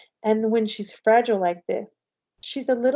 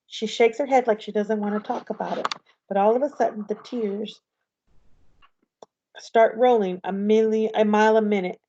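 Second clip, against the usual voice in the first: ordinary delivery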